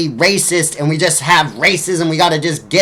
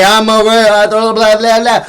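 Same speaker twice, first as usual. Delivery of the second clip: higher, louder mock blabber; mocking racism's voice; 5 semitones higher, 5 dB louder than usual. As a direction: sneer it out like a cartoon bigot. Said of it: "stand up on two legs and say".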